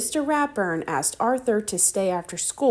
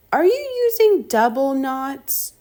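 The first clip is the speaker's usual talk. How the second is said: bossy little sister; 7 semitones higher, 6 dB louder than usual. Acted. DW bossy question.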